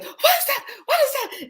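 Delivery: high-pitched mock alarm; mock panic. Wife pranking her husband, mock-panicked squeal.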